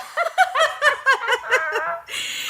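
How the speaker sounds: high shrieking laugh. Real, not acted. Laughing at the reveal.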